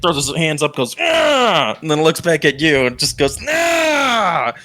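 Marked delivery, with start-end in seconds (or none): 1.0-1.8 high, drawn-out "aah" of exasperation; exasperated cry. Acted. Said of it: "the dude kinda stares at the pig". 3.5-4.5 high, drawn-out wail of frustration; upset cry. Acted. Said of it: "he's kind of upset".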